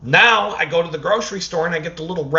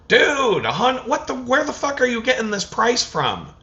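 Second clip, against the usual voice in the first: incredulous protest; 10 semitones higher than usual. Retold or imitated. Arguing with the cashier.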